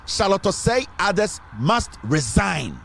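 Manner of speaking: slow, deliberate quoting of the demand; bold statement. Retold or imitated. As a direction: spell out the demand word by word. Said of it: "make emphatic statements".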